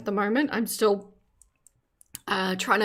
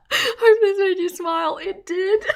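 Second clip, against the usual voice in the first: giggling; 11 semitones higher, 4 dB louder than usual. Real, not acted. Reads sweet superchat, giggling.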